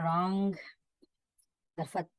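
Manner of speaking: ordinary delivery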